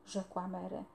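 ordinary delivery, nothing marked

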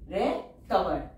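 Recover drawn out very slowly; slow teacher dictation. Shown as a demonstration. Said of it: "teacher says word then dictates".

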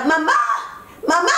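mock cry for mother, rising sharply; short storybook yell with rising end; calling out. A narrator's reading. Running girl calls for her mother, rising cry. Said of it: "grita en voz alta".

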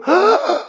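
high startled gasp of disbelief; sharp gasp. Acted. Man seeing his bank account, sharp shocked gasp. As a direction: gasp at the sight of the money.

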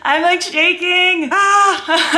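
high-pitched giddy squealing with laughter; freaking out. Real, not acted. Vlogger one minute from launch, freaking out. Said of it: "I'm freaking out".

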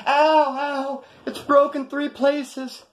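high, drawn-out mock whine of pain; hammed-up fake pain. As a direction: overplay her faked injury, slowly. Wife faking hand injury.

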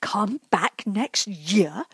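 indignant, slow, disbelieving echo; hotly repeated. A narrator's reading. Paddington echoing the store manager, slowly and indignantly. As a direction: throw the manager's words back, offended. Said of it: "repeated Paddington hotly".

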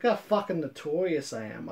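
normal talking voice

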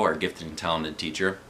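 plain reading voice

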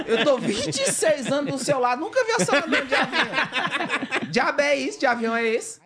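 mock outrage cracking into laughter; laughing incredulous retort; laughing disbelief.

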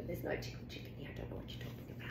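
hushed playful whisper, voice gone; conspiratorial storybook whisper. Pretend. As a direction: breathe it like a secret game. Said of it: "reader whispers to hide chicken".

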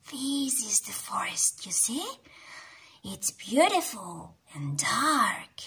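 soft, secret whisper. Acted. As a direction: draw the kids in with a whisper. Hushed aside to children.

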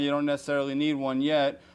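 normal talking voice

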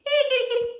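very high squeaky call to the cat; calling kitty. Real, not acted. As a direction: sing out to the cat in a tiny voice.

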